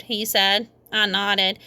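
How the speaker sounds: plain reading voice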